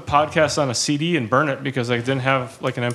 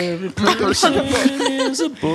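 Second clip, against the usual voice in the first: mocking voice; 10 semitones higher, slower than usual. Mocking a quote.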